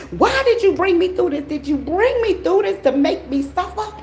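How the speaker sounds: high, pleading voice of a troubled follower; pleading viewer. Retold or imitated. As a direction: ask why like a confused, hurting believer. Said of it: "Some of y'all wondering".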